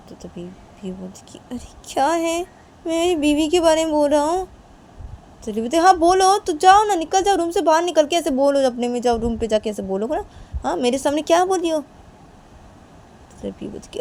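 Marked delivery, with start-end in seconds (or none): 1.5-4.5 high-pitched, put-upon retort; exasperated protest. Acted. Defending talk about the wife, exasperated protest. 5.8-11.8 high, loud burst easing slightly; angry dismissal. Acted. Sending the other out to talk elsewhere, angry. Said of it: "तुम ऐसे गुस्सा क्यों हो रही हो".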